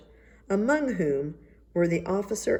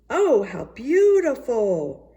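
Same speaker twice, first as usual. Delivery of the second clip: slow, higher and louder gasp of admiration; exclaimed; 6 semitones higher, 6 dB louder, much slower than usual. A narrator's reading. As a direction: draw out the words in staged awe. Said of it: "they all exclaimed".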